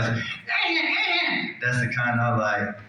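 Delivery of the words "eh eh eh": shrill high-pitched yelped eh eh eh; cartoonish high squeal of protest. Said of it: "she looks back and goes".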